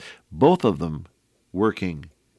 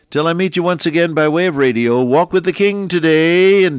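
louder, higher announcer farewell; radio sign-off; 9 semitones higher, 8 dB louder than usual. Acted. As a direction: sign off the broadcast with gusto.

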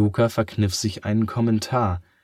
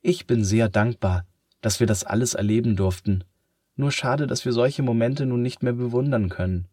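ordinary delivery

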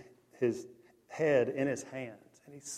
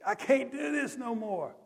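retold scream; 10 semitones higher than usual. Preacher echoing the fifteen-year-old camper's plea, mock scream. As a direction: strain it up high, pleading. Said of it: "he kept screaming".

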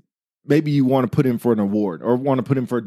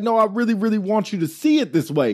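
higher-pitched, insistent plea; acted passion; 8 semitones higher than usual. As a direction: plead your point with passion. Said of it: "I'll be passionate about something".

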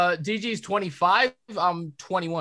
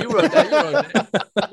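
laughing; 4 semitones higher, 5 dB louder, much slower than usual. Pretend. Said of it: "teasing repetition, laughing".

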